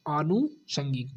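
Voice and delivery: आनुषंगिक said slowly with a long opening आ; slow model word. Shown as a demonstration. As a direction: stretch out आनुषंगिक. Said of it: "आनुषंगिक होगा". (आनुषंगिक धीरे, लंबे आ के साथ)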